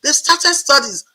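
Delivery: imitating an eager administrator's excitement; eager urging. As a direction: shoo her into class with delight. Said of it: "And she said".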